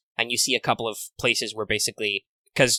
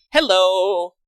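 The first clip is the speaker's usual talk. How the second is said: mock gentle greeting; 8 semitones higher, 5 dB louder than usual. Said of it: "gently stroll down the ramp and be like".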